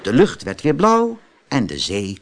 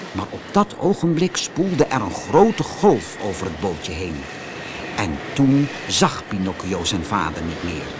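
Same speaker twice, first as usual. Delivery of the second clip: plain reading voice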